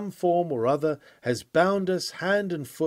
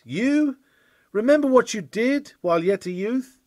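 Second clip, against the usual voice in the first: high, loud, taunting accuser; accusing sin's voice; 8 semitones higher, 6 dB louder than usual. Retold or imitated. A preacher voicing his own sin taunting him, high and loud. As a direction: throw his youth back at him like a jeering sin. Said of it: "says one of my sins".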